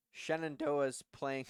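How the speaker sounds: voice crack. Real, not acted. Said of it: "voice crack".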